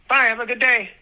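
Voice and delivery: high-pitched toddler imitation; baby speak. Toddler saying bye as dad leaves for work, imitated baby speak. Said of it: "In baby speak".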